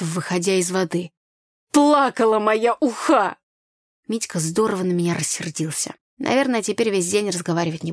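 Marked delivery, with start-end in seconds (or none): 1.7-3.4 higher, louder, drawn-out complaint; disgruntled lament. A narrator's reading. Brother over his lost fish soup, disgruntled. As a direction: lament it with annoyance.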